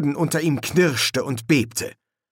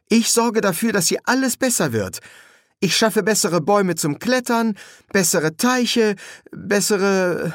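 put-on high, insistent boyish promises; defiant, doubt creeping in; 10 semitones higher than usual. A narrator's reading. Boy promises doubting friends improvements, defiant and increasingly unsure. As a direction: promise stubbornly, then falter into doubt. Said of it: "Trotz zitterte in seiner Stimme, und plötzlicher Zweifel".

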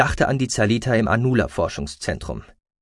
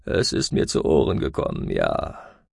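put-on low, dry old Ara voice, half-breathed; a frail, guarded elder; croaking old man.

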